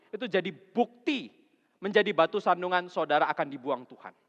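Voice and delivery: loud, high, driving sermon voice; full-force preaching. Acted. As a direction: preach it hard to the congregation. (khotbah berapi-api dengan suara keras)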